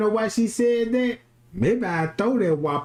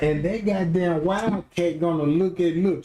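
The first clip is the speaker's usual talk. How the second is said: normal talking voice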